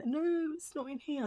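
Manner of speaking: mimicked pharmacy staff. Staff's refusal repeated in retelling.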